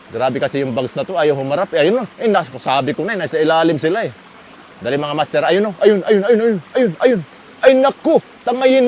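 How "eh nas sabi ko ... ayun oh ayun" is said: ordinary delivery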